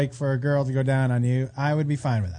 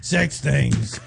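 imitating creepy guy voice; 4 semitones lower, much slower than usual. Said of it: "it sounds like somebody from The Simpsons in the bar".

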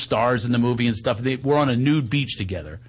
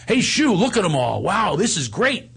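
higher, excited movie line; scripted line as intended; 6 semitones higher than usual. Acted. Actor's line on a nude beach, eagerly delivered. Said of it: "That was his line".

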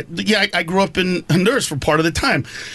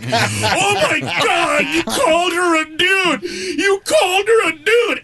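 high, slow, stretched-out gasp of shock; shocked coworkers; 9 semitones higher, slower than usual. Retold or imitated. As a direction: mimic the outraged crowd. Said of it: "everybody in the back, like".

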